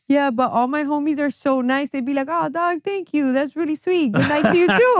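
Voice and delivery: a hint of a sappy bro thanking his friend; mock sweet homies. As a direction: play the too-nice homie, lightly mocking. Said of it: "And he's like".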